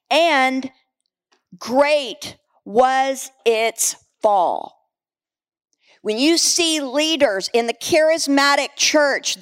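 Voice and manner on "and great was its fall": very slow, word by word with pauses; solemn and drawn out. Real, not acted. Preacher closing a scripture reading, solemn and drawn out. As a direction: let each word land slowly.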